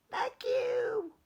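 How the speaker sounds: tiny whispered squeak; squeaky mice. Acted. Baby mice thanking George from the shoe, whispered squeak. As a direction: breathe a tiny high thank you. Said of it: "they squeaked".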